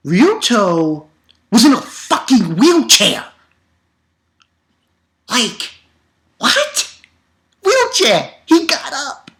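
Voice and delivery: high, loud disbelief; can't believe he stood up; astonished; amazed. Real, not acted. Amazed retelling.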